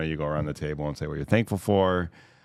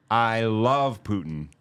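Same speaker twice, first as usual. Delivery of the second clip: slow, raised, mock-theatrical proclamation; hammed-up party-game clue; dramatic declaration; 4 semitones higher, much slower than usual. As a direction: imitate a grand declaration, stretching the words out. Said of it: "very dramatically and was like".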